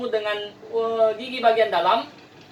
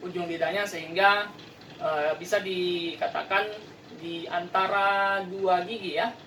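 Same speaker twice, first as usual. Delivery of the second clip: ordinary talk, nothing marked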